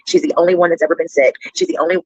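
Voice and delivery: ordinary talk, nothing marked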